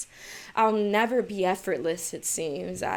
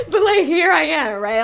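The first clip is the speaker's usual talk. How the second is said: loud laughing self-mockery; 8 semitones higher, 12 dB louder than usual. Real, not acted. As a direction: laugh it out at yourself. Laughing at herself.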